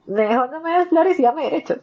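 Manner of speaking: high, pointed demand; voicing a fed-up mother. A mother telling society she wants rights, not flowers, in a high pointed voice. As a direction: pitch it up like a tired mom making her demand.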